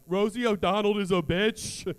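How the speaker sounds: a light Trump impression at the presidents ride, slow and loud. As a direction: drag it out like a blunt Trump jab. Trump animatronic quote.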